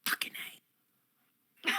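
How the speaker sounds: ordinary talk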